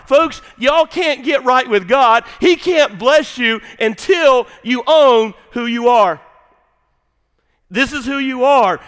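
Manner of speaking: slow, loud, fervent pulpit voice; preaching. Acted. Preacher exhorting his church, slow loud fervent preaching. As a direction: drive it home to the congregation.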